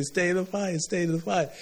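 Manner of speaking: mock exhorting voice. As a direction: mimic someone urging over and over. Preacher voices a repeated call.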